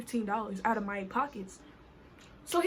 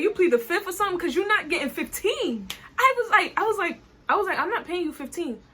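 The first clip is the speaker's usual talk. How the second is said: higher and louder, arguing back hard; arguing with the driver, a heated raised voice; heated retort; 6 semitones higher, 5 dB louder than usual. Retold or imitated. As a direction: push back at him, fired up.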